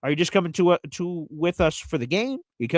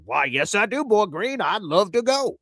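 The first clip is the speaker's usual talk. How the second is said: a silly made-up character voice, pitched up; odd put-on voice; 5 semitones higher than usual. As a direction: play a goofy fan accepting the invite. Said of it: "I don't know what that voice is".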